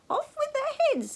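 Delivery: very high, loud, slow royal command. A narrator's reading. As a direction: command the executions like a mad old king. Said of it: "he commanded".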